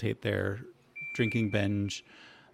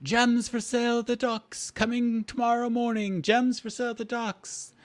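high-pitched street crier's call; screaming barker; 13 semitones higher, 4 dB louder than usual. Acted. Barker advertising gems to the town, high crying out. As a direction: cry your wares across the town. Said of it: "you a-, actually heard a barker screaming out".

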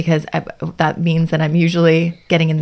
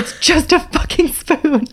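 high, slow exasperated snap; frustrated spoon rant; 10 semitones higher, slower than usual. Pretend. Exasperated imagined outburst.

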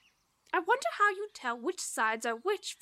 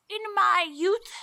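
put-on wise old sage; 6 semitones higher, 6 dB louder, slower than usual. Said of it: "said the sage".

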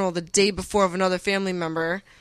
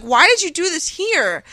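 high, drawn-out incredulous question; retold exasperation; 8 semitones higher, 3 dB louder than usual. Fiancée asking why he proposed here, retold exasperated plea. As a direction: demand an explanation, pitched high and slow.

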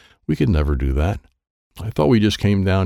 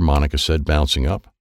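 plain reading voice

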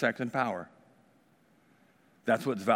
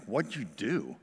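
confronting Moses; 4 semitones lower, 7 dB quieter, slower than usual. Retold or imitated. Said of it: "when Moses confronts Aaron".

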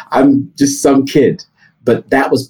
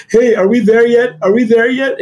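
put-on high whiny backseat-kid voice, mildly raised; shouting kids; 10 semitones higher than usual. Kids nagging the driver on a long car trip, mock kid shouting. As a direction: mimic impatient kids pestering from the back seat. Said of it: "and they're shouting".

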